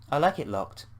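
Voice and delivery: ordinary delivery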